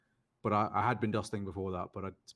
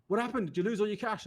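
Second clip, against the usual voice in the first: surprised friends; 10 semitones higher than usual. Retold or imitated. Friends asking about sudden return.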